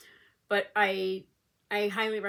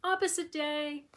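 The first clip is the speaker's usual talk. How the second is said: the dog talking back, pitched higher and slower; a playful made-up dog voice, higher than her own; the dog's voice; 7 semitones higher than usual. Retold or imitated. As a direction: voice the dog's cheeky reply, drawn out up high. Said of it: "he reads the text and is like".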